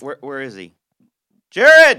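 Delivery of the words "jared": calling out loud. Real, not acted. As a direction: shout his name across the room. Calling out for missing guest.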